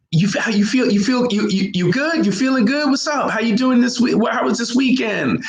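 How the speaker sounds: high, lively buddy check-in; chatty American small talk. Retold or imitated. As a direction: pepper them with upbeat how-are-yous. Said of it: "like, how we do in America".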